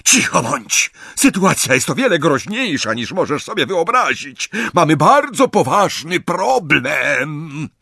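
high, urgent shouting down; shouted rebuke. A narrator's reading. Bellatrix silences sister over grave danger, shouting. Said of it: "ale Bellatrix krzyknęła".

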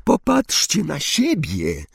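high, drawn-out exclamation; narrator's restrained version of a shriek; mild shriek. A narrator's reading. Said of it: "wrzasnęła Hermiona".

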